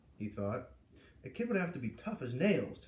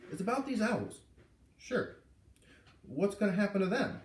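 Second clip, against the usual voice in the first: high, curious officer; 7 semitones higher than usual. A narrator's reading. Officer asking the foreman about the owls, high and curious. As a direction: lift the voice up, gently curious.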